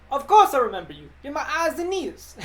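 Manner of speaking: hammed-up heavy New York accent; Peter Parker, heavy New York accent. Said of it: "Peter Parker heavy New York accent".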